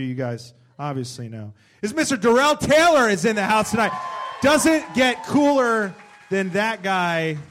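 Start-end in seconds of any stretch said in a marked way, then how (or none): 1.8-7.4 loud, high intro, slow on the praise; hype announcer. Acted. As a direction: pump up the crowd for him. Host introduces second guest.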